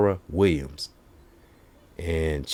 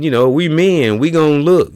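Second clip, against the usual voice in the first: higher, louder put-on voice; mock man explaining; 9 semitones higher, 8 dB louder than usual. Comic justifying men staring, loud high mock voice. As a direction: declare it pitched up. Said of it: "He said".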